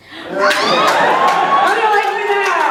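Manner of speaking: high, slow triumphant gloat. Retold or imitated. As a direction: rub it in, drawn out and smug. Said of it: "I went".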